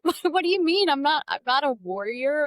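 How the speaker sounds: high, hammed-up offended voice, laughing through it; mock indignant protest. To the interviewer, mocking her own offended self, hammed-up indignation. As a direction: play the wounded pacifist with a laugh.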